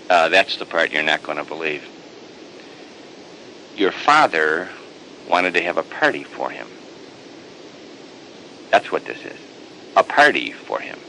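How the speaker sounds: low, offhand hired hand voice. Acted. Hired hand tells the older son, low and casual. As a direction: drop down low and gossip it.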